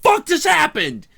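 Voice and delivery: high, loud shocked outburst; high, loud panicked what-the; startled yell. Retold or imitated. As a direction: leap out of your seat in shock. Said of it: "I'm like".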